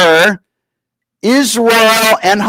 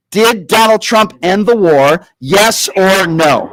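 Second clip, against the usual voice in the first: slow, condescending; slower than usual. Shown as a demonstration. Host to caller, slowly and condescendingly. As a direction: stretch out each word slowly. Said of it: "Let's try to go very slowly".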